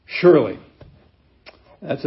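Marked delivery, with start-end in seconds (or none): 0.1-0.6 Surely punched out louder, falling at the end; emphatic surely. Shown as a demonstration. Pastor stressing the Hebrew word to the congregation, emphatic. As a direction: hit Surely hard as one weighty word. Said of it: "emphatic Hebrew word".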